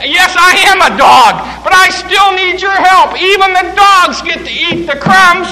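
loud, high defiant plea; mother shouting back her plea to Jesus. Acted. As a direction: shout back with stubborn faith.

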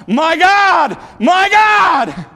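loud, high anguished cry out; preacher voicing Jesus on the cross, loud and high. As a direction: cry it out to heaven in agony. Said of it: "causing Jesus to cry out".

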